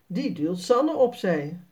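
ordinary delivery, nothing marked

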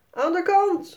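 hoge, luide roep; roepen. A narrator's reading. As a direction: roep iemand opzij tijdens het racen. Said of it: "roepen de beide mama's". (moeders roepen naar een meneer op het ijs)

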